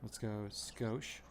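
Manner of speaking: ordinary talk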